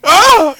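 high, loud yelp of pain; mock scream. Teacher screaming on a stretcher, imitated shriek. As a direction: let out a short pretend shriek. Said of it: "as she's screaming".

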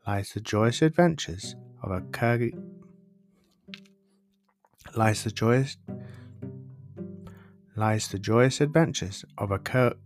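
plain reading voice

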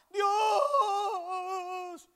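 long, high, very loud wail; crying scream. Retold or imitated. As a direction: imitate a desperate cry to God, drawn out. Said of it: "miré que lloraba, gritaba". (grito de llanto desgarrado)

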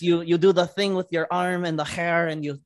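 put-on high, hammy Russian accent; imitating Yelena's Russian accent. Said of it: "Your Russian accent's okay".

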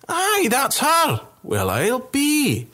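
a boozy soldier dumbstruck; amazed drunk soldier. Acted.

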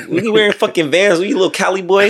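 high-pitched, hammed-up New York taunt; horrible New York accent. Mocking a Cali boy in Vans, bad New York accent. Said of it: "my New York accent was horrible".

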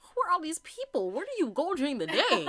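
mock sassy challenge. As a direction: tease her like a nosy friend. Mock-asking Lori about her friends.

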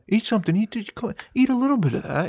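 high, pushy, coaxing father voice; imitating pushy father. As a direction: push food on them like an Italian dad. Father force-feeding at the table.

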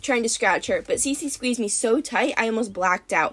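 ordinary delivery, nothing marked

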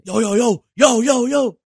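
high, loud retold alarm; imitated panicky shouting; tweaking out. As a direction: call out a frantic warning, acted. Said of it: "they're all tweaking already".

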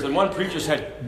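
ordinary delivery

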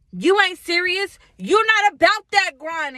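high, loud mock voice of the doubters; mocking naysayers. Vlogger mimicking doubters who call her unserious, high and loud. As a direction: sneer it like the people judging you.

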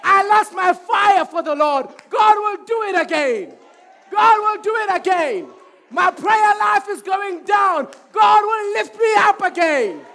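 loud, high preaching cry; preaching at full force. Acted. Preacher rouses the church, loud and high. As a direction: fire up the congregation.

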